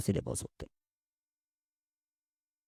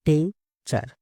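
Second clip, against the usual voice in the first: "Teacher" split into tea, cher with a pause; syllable by syllable. Shown as a demonstration. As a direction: break "Teacher" into two separate syllables. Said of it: "किसी word को धीरे-धीरे बोलना है".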